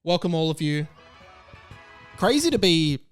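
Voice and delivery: ordinary talk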